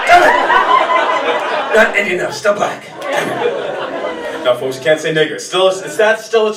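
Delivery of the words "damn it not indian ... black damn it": mock frustration. Comic's mock frustration at failed trick.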